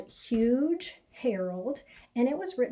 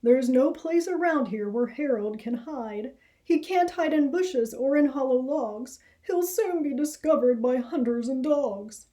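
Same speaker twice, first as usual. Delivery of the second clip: louder, worried rabbit father; an anxious father, louder; 3 semitones higher, 5 dB louder than usual. Acted. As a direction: fret loudly about hunters. Father worrying aloud.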